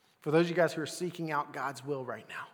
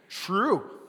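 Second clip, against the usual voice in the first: normal talking voice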